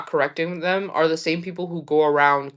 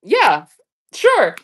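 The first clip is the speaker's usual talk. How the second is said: casual friends; 7 semitones higher, 3 dB louder than usual. Retold or imitated. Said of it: "they're like".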